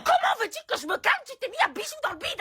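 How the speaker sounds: mother refusing to calm down, indignant; mock outrage. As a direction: fire it off fast and indignant. Mother's outburst.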